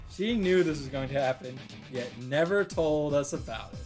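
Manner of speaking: ordinary delivery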